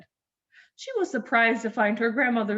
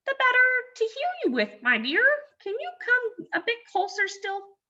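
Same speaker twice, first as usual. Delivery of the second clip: much higher, fast sweet granny; wolf cooing as grandma; fake granny voice.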